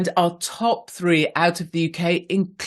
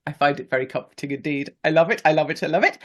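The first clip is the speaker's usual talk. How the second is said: ordinary delivery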